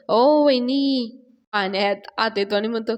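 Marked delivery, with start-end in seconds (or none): 0.1-1.2 long drawn-out, higher call. Acted. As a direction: call after someone, stretching the words slowly. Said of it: "संभ्या हाक मारायला लागतो". (लांब सूर लावून हाक मारणे)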